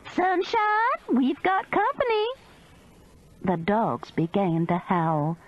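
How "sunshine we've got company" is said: high calling scream toward the trailer; screaming a name to the trailer. A narrator's reading. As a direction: call out across the yard. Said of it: "turned toward the trailer and screamed".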